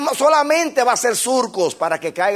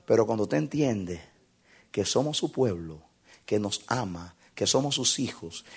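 low, soft intimate sermon voice; hushed preaching; 9 semitones lower, 8 dB quieter than usual. Acted. Preacher talking softly to the congregation about God's voice. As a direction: draw them close, speaking low.

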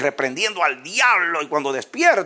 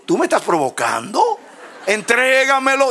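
provoked devil; 10 semitones higher than usual. Retold or imitated. Dice el diablo. (diablo indignado que exige a gritos)

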